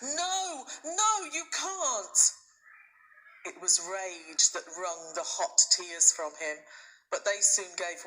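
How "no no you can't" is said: high, slow anguished shout; boy shouting at his father, pitched very high. A narrator's reading. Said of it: "had ever shouted at his father".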